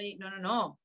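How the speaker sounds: usual speaking voice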